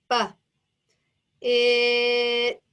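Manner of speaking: "B, it" slowly pulled apart, sound by sound; short "B", a long pause, then a loud, long-held "it". Shown as a demonstration. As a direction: stretch the vowel of "it" and release the t separately.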